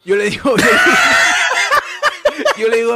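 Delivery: high, loud laughing outburst; laughing hard. Real, not acted. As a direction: laugh out the words. Laughing burst. (riéndose a gritos)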